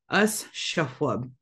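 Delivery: ordinary talk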